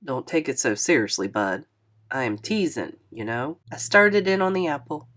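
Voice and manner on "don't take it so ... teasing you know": disappointed. A narrator's reading. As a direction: lower and softer, a mildly let-down older man. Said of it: "sounded kind of disappointed".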